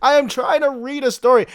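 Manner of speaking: loud, high annoyed scold; exasperated. Real, not acted. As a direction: snap at the chatters. Scolding chatting viewers.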